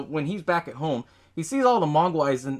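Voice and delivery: ordinary talk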